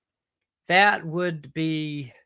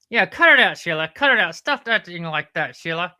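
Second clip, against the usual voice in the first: mock scolding; 7 dB louder than usual. Sidekick telling Sheila to stop it, mock scolding.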